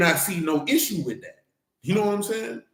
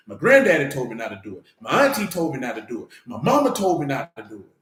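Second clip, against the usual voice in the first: ordinary delivery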